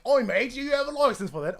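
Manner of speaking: loud, high mock English "oi mate" voice; hammed-up informal English accent, belted out; unposh English accent. Shown as a demonstration. Challenging a mate over a license, unposh English accent. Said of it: "you either have to go like".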